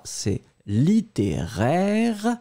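littéraire very slow, ending in "-aire"; slow model pronunciation. Shown as a demonstration. Teacher modelling littéraire against littéral. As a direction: draw out littéraire syllable by syllable. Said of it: "prenons le temps de bien prononcer les deux".

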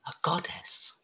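soft hushed reveal; whisper. A narrator's reading. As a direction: drop to a whisper for the surprise. Suspenseful reveal of second goddess.

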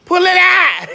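high, loud demand building to a shout; excited shout. Retold or imitated. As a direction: holler it out, thrilled and high. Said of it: "I was like".

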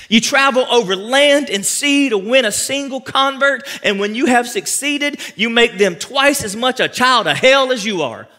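loud, slow scripture rebuke; forceful preaching. Acted. Preacher reading verse fifteen to the church, loud and slow. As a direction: bellow it out from the pulpit.

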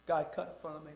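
usual speaking voice

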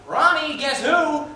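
high loud call across the house. Retold or imitated. Father calling his son to greet the guest, loud. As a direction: call out to the kid, excited. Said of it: "my father called out".

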